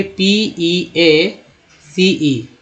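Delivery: very slow, drawn-out letter-by-letter spelling; spelled out letter by letter. Shown as a demonstration. Teacher spelling peace for students, very slowly letter by letter. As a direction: stretch out each letter of the word. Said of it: "पीस रखिए".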